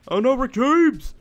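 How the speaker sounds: loud startled cry of the name; sudden loud alarm at a spawning mob; alarmed yell. Real, not acted. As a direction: shout it out as the enemy appears. Minecraft player yelling at enemy.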